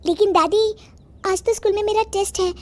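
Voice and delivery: put-on high-pitched little girl voice; young granddaughter. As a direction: protest to grandma in a high child's voice. (ऊँची आवाज़ में बच्ची)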